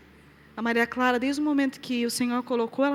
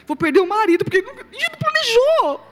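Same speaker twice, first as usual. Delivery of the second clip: desperate; 10 semitones higher, 5 dB louder than usual. Retold or imitated. Desperate, crying at ultrasound.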